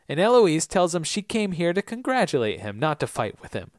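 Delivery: ordinary talk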